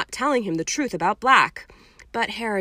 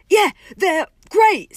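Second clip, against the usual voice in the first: high, loud, slow fake cheer; overdone fibbing praise; fake enthusiasm; 11 semitones higher, 10 dB louder, slower than usual. A narrator's reading. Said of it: "crossing his fingers".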